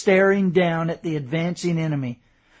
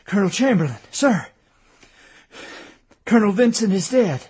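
out of breath; 5 semitones higher, 5 dB louder, faster than usual. A narrator's reading. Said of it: "out of breath".

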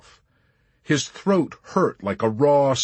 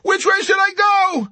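suffering high yell; furious high-pitched shout; 8 dB louder than usual. A narrator's reading. Pilot McWatt begging directions over the intercom, furious shout. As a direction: demand it at full shout. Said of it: "shouted furiously over the intercom".

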